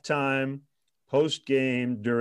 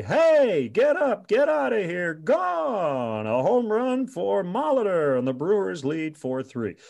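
big home run call; 8 semitones higher than usual. Retold or imitated. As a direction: belt it like a play-by-play man calling a homer. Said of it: "he would typically say".

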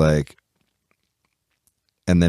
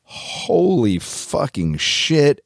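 stunned; 8 semitones higher, much slower than usual. Retold or imitated. Retold shock at layoff news.

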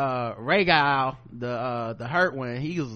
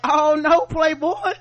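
mock refusal; 8 semitones higher, slower than usual. Bron refusing, mocking.

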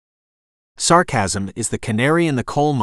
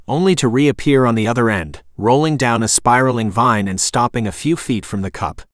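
plain reading voice

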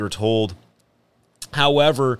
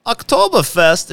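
high, hammed-up German accent; 13 semitones higher, 4 dB louder than usual. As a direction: sing out the brand like a German pitchman. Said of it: "That's more German".

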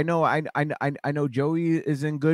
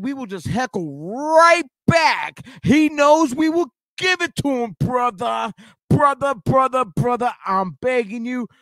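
louder, higher mock promo yell; wrestling promo shout; 8 semitones higher, 4 dB louder than usual. Mock heckling, wrestling promo style.

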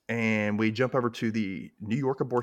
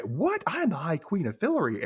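indignant queen; 7 semitones higher than usual. Retold or imitated. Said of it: "And she's like".

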